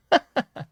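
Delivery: quick high burst of a laugh; short high laugh. Real, not acted. Host laughing at his own catchphrase, quick high laugh. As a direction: laugh at your own phrase.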